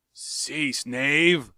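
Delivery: sharp rebuke; angry cry. Acted. Tario rebuking Jav, angry. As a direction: cut him off angrily. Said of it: "cried Tario".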